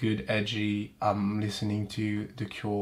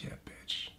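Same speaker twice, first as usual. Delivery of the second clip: whisper; 15 dB quieter than usual. Pretend. Pollen voiced as taunting.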